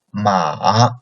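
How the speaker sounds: "ma" dipping low and coming back up, third tone. Shown as a demonstration. Teacher reading the four tones to students, low dipping "ma". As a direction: dip "ma" to the bottom. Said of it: "let's read again".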